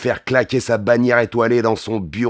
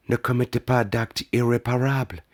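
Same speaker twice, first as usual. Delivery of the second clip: soft female-partner voice, slightly raised; Molly's voice; 4 semitones higher, 4 dB quieter than usual. A narrator's reading. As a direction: plead gently with the hero. Narrator voices Molly pleading. (voix féminine douce et suppliante)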